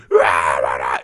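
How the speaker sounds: low, loud mock roar of a tantrum. Imitating his kid's bedtime meltdown, mock roar. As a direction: growl like a toddler melting down.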